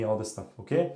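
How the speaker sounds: ordinary talk, nothing marked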